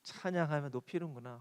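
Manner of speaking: low, soft, fond acceptance; like a doting parent, softly; God gently accepting a child's praise; tender fond voice. Pretend.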